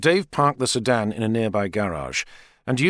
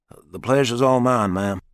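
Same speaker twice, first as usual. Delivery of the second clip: put-on slow, courteous American accent. A narrator's reading. Richard greets Sarah's mother in an easy American accent. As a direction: charm her in an American drawl. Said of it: "with an accent like that".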